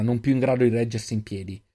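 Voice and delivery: ordinary delivery, nothing marked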